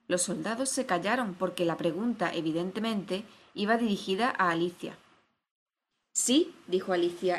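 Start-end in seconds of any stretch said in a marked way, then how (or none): 6.1-6.5 high, eager reply; enthusiastic. A narrator's reading. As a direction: answer with keen enthusiasm.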